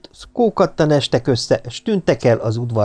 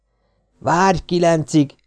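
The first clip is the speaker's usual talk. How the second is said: slow, persuading plea; coaxing; 5 semitones higher, slower than usual. Acted. Svekla urging the new friend to stay until nine, coaxing. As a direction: talk him into staying. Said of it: "beszélte rá Svekla".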